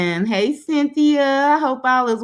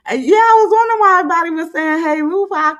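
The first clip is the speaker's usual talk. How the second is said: fast, loud and higher, suddenly catching on; surprised realization; 6 semitones higher, 6 dB louder, much faster than usual. Real, not acted. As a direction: blurt it out as it dawns on you. Realizing chat greeted the wrong person.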